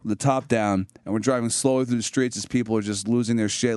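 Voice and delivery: ordinary talk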